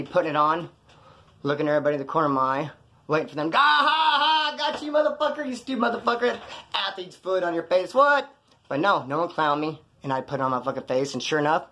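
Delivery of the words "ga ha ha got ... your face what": mocking cackle. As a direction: cackle at the sucker who fell for the prank. Imagined inmates mocking him.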